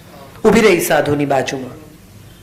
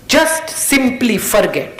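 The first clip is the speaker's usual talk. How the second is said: slow emphatic; 4 semitones higher, much slower than usual. Acted. Closing advice, slowed English.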